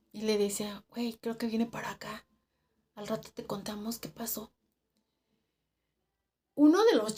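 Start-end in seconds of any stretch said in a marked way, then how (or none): 0.9-4.5 soft, voiceless promise; whispered message. Retold or imitated. Coworker radioing that they will explain later, whispered. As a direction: whisper it secretively.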